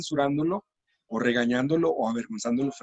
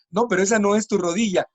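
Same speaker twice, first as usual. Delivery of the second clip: corrección rápida, más aguda y fuerte; maestros corrigiendo; 6 semitones higher, 4 dB louder than usual. Retold or imitated. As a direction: salta a corregir de inmediato. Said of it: "rápidamente dicen". (maestro corrigiendo al niño enseguida)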